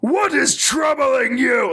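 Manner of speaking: slow, high, shouted mutant yell; Wes Johnson super mutant. Retold or imitated. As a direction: yell it out slowly as a pained giant. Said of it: "Wes Johnson, like, yells".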